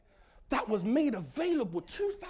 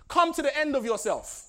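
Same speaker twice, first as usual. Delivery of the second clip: ordinary talk, nothing marked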